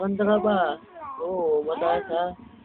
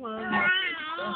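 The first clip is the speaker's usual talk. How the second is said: put-on baby talk; 11 semitones higher, 5 dB quieter than usual. Parent coaxing baby to talk.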